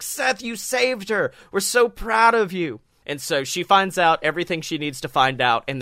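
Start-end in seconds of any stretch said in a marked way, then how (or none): 0.0-2.8 imitating admiring classmates; gushing crowd. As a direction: fawn over the hero. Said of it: "So everyone's like".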